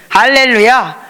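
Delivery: loud joyful hallelujah; exultant cry. Real, not acted. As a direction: shout praise. Preacher's exclamation.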